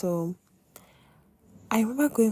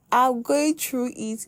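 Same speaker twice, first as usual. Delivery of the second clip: overwhelmed; 6 semitones higher, 4 dB louder, slower than usual. Retold or imitated. Retold reply, overwhelmed.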